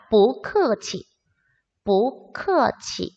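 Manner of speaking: very slow, syllable by syllable; slow pronunciation model. Shown as a demonstration. Teacher models the reply to thanks, very slowly. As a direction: spell out each syllable slowly for learners.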